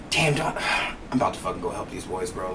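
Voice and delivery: softly sighed inner grumble; sighing to himself. Retold or imitated. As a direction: mutter under your breath, resigned to helping. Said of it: "I'm sitting there and I'm like".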